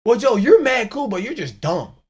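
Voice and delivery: loud, high mock praise; mock flattery. Host imitating a guest's backhanded compliment, loud and high. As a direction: hype him up then undercut. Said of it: "he's gonna be like".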